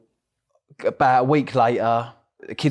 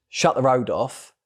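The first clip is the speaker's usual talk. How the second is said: usual speaking voice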